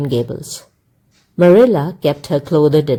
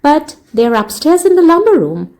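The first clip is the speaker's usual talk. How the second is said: put-on high, bright young lady clerk, somewhat louder; shop clerk voice; 10 semitones higher, 3 dB louder than usual. A narrator's reading. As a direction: voice the dashing young clerk lightly. Shop clerk answers Matthew.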